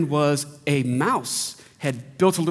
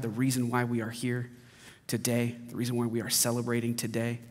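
soft, low-pitched prayer; 5 semitones lower, 7 dB quieter than usual. Real, not acted. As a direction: pray earnestly, letting the voice sink toward the amen. Leads the congregation in prayer.

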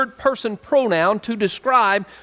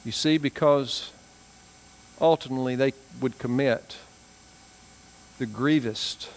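solemn preaching; 7 semitones lower, 7 dB quieter than usual. Real, not acted. Preacher warning hardened hearts, soft and low. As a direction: speak gravely and gently, heavy pauses.

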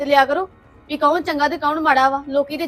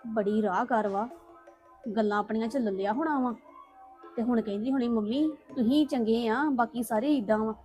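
soft, sulky muttering to herself; quiet grumbling; 2 semitones lower, 9 dB quieter than usual. Acted. Daughter muttering to herself after the family fight, quiet and sulky. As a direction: grumble under your breath, alone and resentful.

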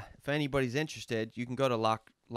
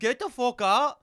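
slow, high, loud mock Irish accent; attempted Irish accent; 13 semitones higher, 10 dB louder, slower than usual. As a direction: draw out a hammed-up Irish dismissal. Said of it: "sounds a little bit more like a Dublin accent".

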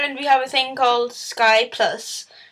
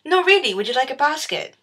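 insistent shop assistant; 3 semitones higher, 5 dB louder than usual. Retold or imitated. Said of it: "they're like".

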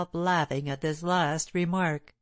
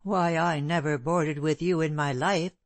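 ordinary delivery, nothing marked

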